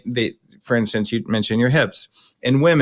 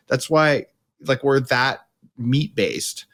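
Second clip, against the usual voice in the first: ordinary talk, nothing marked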